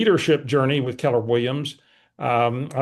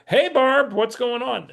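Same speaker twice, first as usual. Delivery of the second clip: high-pitched, overly familiar phone greeting; feigned familiarity; 9 semitones higher, slower than usual. Retold or imitated. Answering an unknown caller as if he knew her, feigned familiarity. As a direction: greet like an old pal, pitched well up. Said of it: "Acting like I knew who she was".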